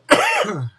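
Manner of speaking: sudden coughing fit; choking. Real, not acted. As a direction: choke and cough hard. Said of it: "Sorry, I'm choking".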